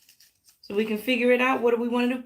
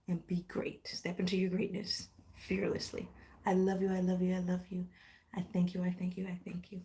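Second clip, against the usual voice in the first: softer and softer; fading; 5 semitones lower, 11 dB quieter than usual. Real, not acted. Trailing off as she signs off. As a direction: let it fade to nothing.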